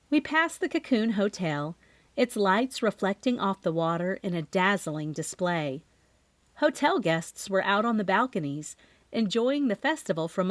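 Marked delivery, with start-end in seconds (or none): none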